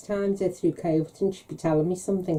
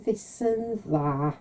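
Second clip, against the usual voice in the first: very slow, softer teasing dodge; coy evasive; 6 dB quieter, much slower than usual. Retold or imitated. Mum dodges her daughter's question again, coyly. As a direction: stretch it out, giving nothing away.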